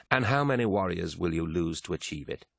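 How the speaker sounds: ordinary delivery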